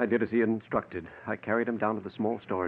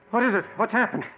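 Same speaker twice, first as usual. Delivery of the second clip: alarmed; 11 semitones higher, 4 dB louder, faster than usual. Acted. Alarmed at hurt brother.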